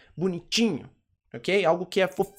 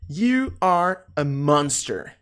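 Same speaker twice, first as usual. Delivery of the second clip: slow, drawn-out teacher's model; slow model sentence; 3 semitones lower, much slower than usual. Shown as a demonstration. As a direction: stretch each word out for learners.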